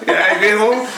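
usual speaking voice

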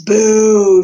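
loud, long, high jeer; shouted boo. A narrator's reading. Botticelli jeering at the crying rat, shouted. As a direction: heckle with a drawn-out boo. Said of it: "shouted Botticelli again".

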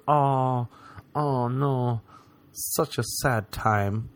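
slow, hammed-up fake sorrow; mock sad post. Mocking dudes who post grief, slow hammed-up sorrow. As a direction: drag out fake sadness, mockingly.

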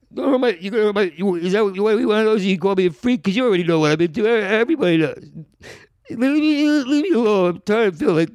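high-pitched, stammering mock tears; fake cry. Hustler conning the man following him, fake crying. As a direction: put on a distraught, tearful whine. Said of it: "I would fake cry".

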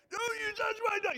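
high, loud, fast shout; protective yell. Retold or imitated. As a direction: roar it to protect your child. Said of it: "and I yelled".

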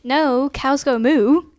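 loud, slow "moo" at the end; drawn-out moo punchline. Acted.

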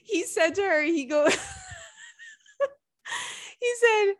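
high, squeaky voice cracking up mid-quote; struggling through laughter. Real, not acted. Podcaster retelling a man's insult in bed, struggling through laughter. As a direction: push the insult out while falling apart laughing. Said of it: "I can't even say it".

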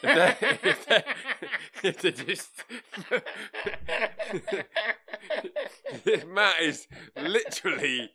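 high, loud laugh-through words. Real, not acted. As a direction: crack up mid-sentence.

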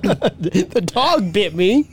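drawn-out slow repeat; slow mock repeat. Friend slowly repeats the dad's complaint, mocking. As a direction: savour the absurd line slowly.

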